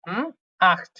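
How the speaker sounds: আট said as German acht, ending in a throaty খ sound. Shown as a demonstration. Teacher models German eight with the kh sound. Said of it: "খ এর মতো উচ্চারণ".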